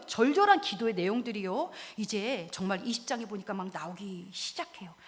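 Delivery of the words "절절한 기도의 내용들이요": normal talking voice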